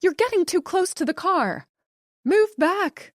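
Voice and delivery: high, loud warning; wife yelling at her husband to move back; shouting. A narrator's reading. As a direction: yell at him to get back. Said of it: "she shouted through the screen".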